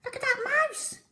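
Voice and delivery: high, quick monkey chatter. Acted. Monkey gasps at the brave mouse, high chatter. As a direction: squeak it out like an excited monkey. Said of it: "chattered a monkey".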